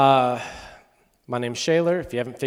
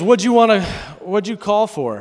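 much louder, higher, blunt dad voice; dad's gruff question; 9 semitones higher, 9 dB louder than usual. Retold or imitated. Dad on the phone asking why his son called, loud and blunt. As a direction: play the dad getting to the point.

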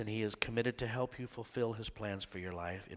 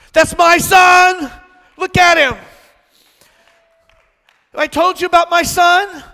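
put-on booming proud father shouting over his children; God shouting with joy; 23 dB louder than usual. God shouting over his children with joy, a booming proud father. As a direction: shout it out like a proud dad showing off his kids.

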